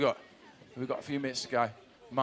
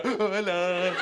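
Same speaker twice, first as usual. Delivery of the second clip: 1 mock camera greeting; much slower than usual. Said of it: "don't look at the camera and go".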